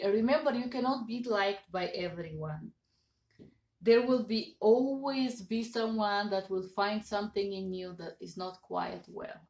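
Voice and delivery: ordinary talk, nothing marked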